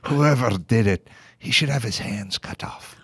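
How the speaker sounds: low, grave pronouncement; stern boss. Retold or imitated. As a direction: hand down a harsh sentence. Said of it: "And he goes".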